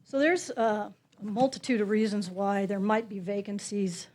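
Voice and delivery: ordinary delivery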